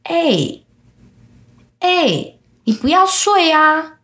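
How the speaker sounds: put-on high, childlike voice, coaxing and insistent; high-pitched eerie kids pleading; ghost children calling. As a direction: pipe it up like spooky kids calling through the window. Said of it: "从我的窗户那边传来，就说".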